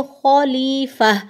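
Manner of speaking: very slow खलीफा with the kha full and heavy. Shown as a demonstration. Teacher corrects the light kha with a heavy खलीफा. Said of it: "खलीफा नहीं पढ़ेंगे".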